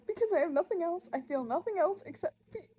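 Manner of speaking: high, tearful voice breaking up; crying, voice dropping out mid-sentence; heartbroken. Real, not acted. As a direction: struggle to speak through real tears. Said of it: "I'm heartbroken".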